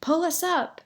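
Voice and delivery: high-pitched call upward; hollered. A narrator's reading. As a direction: holler it up to the helpers. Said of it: "her booming voice holler".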